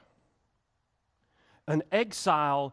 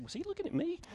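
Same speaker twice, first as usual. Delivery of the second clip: high, hushed, incredulous imitation of a congregant; mock surprised question; 13 semitones higher, 9 dB quieter, faster than usual. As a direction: squeak it up high like you can't believe it. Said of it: "he looked at Casey and said".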